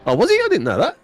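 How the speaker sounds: high, surprised question. Real, not acted. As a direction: genuinely caught off guard. Surprised at SpongeBob news.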